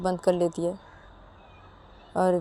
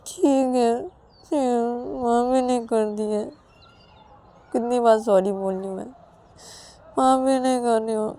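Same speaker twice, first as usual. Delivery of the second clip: plaintive, higher-pitched grumble; sulky Ananya, hurt; 5 semitones higher than usual. Acted. Refused Ananya complains that sorry is not enough, hurt and sulky. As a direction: give in with a wounded whine.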